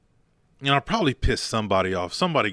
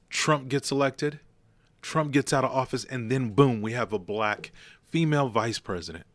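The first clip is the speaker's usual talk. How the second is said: usual speaking voice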